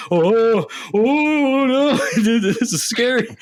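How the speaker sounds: high, loud hammed-up wail of fright; mock scared. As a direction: retell a freak-out with a high, drawn-out cry. Retold scared reaction to the game.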